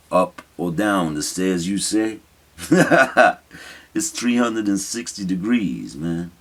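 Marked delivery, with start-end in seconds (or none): 2.6-3.3 a quick amused laugh that drops away; short real laugh. Real, not acted. Poet laughing at his own stair riddle. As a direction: let a brief laugh slip out at your own joke.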